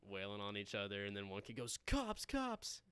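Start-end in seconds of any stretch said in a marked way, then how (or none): 1.9-2.8 mock warning cry. Kid warns of cops at fight.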